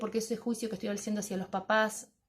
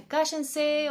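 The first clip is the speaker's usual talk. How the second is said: sharp mock shout at noisy kids, higher and louder; stressed yell; 7 semitones higher, 4 dB louder than usual. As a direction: snap at the class to shut up. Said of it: "o incluso la expresión es".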